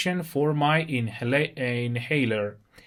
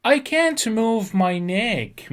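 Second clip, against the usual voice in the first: slow and raised, each word set apart; slow model reading; 8 semitones higher, 3 dB louder, much slower than usual. Shown as a demonstration. Teacher reading a patient's sentence slowly to students, higher and slow. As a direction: read it out slowly for the class to copy. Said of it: "میگه که نمیتونم گردنم رو تکون بدم".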